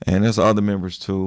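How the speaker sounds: usual speaking voice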